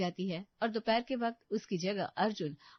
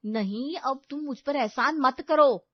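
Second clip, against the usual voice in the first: slow, loud, offended emphasis; wounded pride, voice raised; huffy refusal; 3 semitones higher, 7 dB louder, slower than usual. A narrator's reading. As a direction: refuse the favour indignantly, drawn out.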